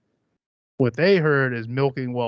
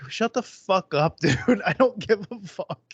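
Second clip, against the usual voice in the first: exasperated retort; 8 semitones higher, slower than usual. Acted. Exasperated retort to mocked country song.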